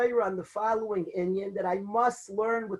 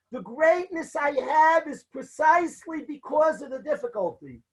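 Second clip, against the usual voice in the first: fervent declaration; 3 semitones higher than usual. Retold or imitated. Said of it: "He says".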